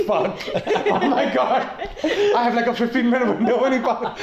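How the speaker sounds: giggling through a fake freak-out; mock panic. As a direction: play the frantic heroine for laughs. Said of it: "he's like".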